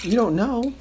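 high incredulous question; surprised. Real, not acted. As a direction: sound amazed he doesn't know.

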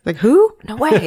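high, loud mock disbelief; playful fake disbelief; mock incredulity. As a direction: pretend to be astonished. Mocking the wrong emphasis.

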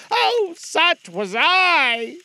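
high, slow moaning ghost; ghostly wail. Acted. Marley's ghost moaning to Scrooge. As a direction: draw the words out like a wailing spirit.

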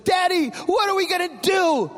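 put-on high child voice, worried; a small boy tugging at dad's shirt; little boy asking. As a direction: pipe up like a six-year-old.

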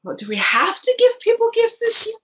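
high retold worry. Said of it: "it was just like".

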